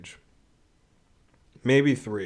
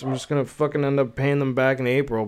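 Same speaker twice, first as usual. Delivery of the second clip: usual speaking voice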